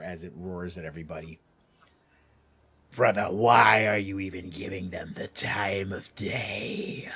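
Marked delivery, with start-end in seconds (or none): 3.0-7.1 slow breathy sneer from a dragon; a dragon scoffing at its brother; whispered dragon contempt; scornful dragon whisper. Acted. Second dragon scolds brother.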